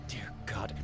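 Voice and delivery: breathless, stunned whisper; shocked whisper. Acted. Monk hearing of a priest lover, whispered in shock. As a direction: barely voice it, stunned by what you just heard.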